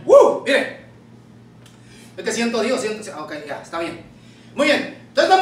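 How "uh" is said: sudden high, loud "uh"; exhilarated whoop. Real, not acted. Preacher feeling God's presence, exhilarated whoop. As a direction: let out a short, high whoop.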